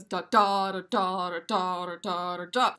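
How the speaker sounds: loud, fast, mechanical da da beat; sing-song meter. Shown as a demonstration. Teacher mocking a robotic reading, loud sing-song. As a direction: bang out the beat like a metronome. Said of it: "we're obviously not gonna read it as".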